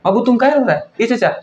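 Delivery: high, sing-song fake sympathy; mock baby-talk consoling. Vlogger mockingly coos at crying Imran in high baby-talk.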